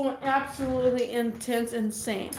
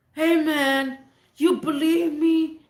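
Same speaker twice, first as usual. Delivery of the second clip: put-on drunk plea; 4 semitones higher, 5 dB louder, slower than usual. Drunk man pleading with neighbor.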